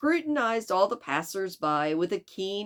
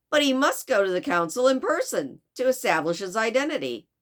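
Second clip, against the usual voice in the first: put-on loud, higher-pitched rough Chicago accent; Mister Fix; 7 semitones higher, 6 dB louder, faster than usual. Detective sends servant's master to the consul, poor Chicago accent. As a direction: insist firmly in an attempted Chicago accent. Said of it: "my very poor attempt at a Chicago accent".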